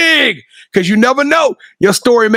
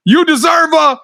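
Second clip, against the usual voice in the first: hype; 6 semitones higher, much slower than usual. Real, not acted. Hyping the guest.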